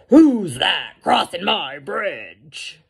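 loud, slow troll challenge; mean, mad troll. A narrator's reading. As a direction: demand it like an angry bridge troll. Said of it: "And the troll says".

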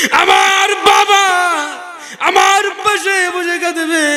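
high, insistent chanted plea; impassioned supplication. Real, not acted. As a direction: push the prayer up into a high cry. Pictures weeping family at deathbed. (চড়া সুরে আবেগভরা মোনাজাত)

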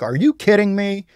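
tense rhetorical question. Shown as a demonstration. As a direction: throw it back, incredulous. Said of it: "read with a lot of tension".

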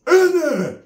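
a high hammed-up yell of a cousin; mock loud yell. Cousin hollering from the car window in a mock loud yell. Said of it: "Hella loud".

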